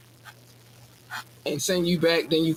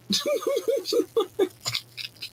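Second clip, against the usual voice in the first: high-pitched giggle. Real, not acted. Laughing at her own orange-sun joke, a high giggle. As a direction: let out a squeaky giggle.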